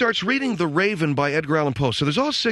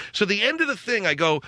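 ordinary talk

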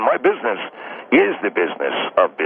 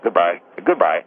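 ordinary talk, nothing marked

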